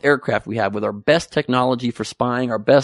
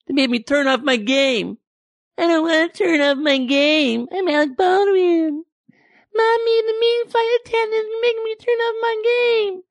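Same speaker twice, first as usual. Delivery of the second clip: put-on whiny baby Baldwin. Comic mocks Baldwin as a whiny baby, very high-pitched. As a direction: whimper it like a spoiled toddler. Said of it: "he's a bit of a baby".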